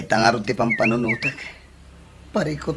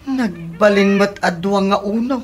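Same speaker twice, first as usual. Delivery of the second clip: much louder and higher in disbelief; shocked outcry; 8 semitones higher, 7 dB louder than usual. Acted. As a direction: shout the number in dismay. Candidate shocked at ranking.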